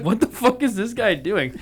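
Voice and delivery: half-laughing disbelief; laughing quote. Retold or imitated.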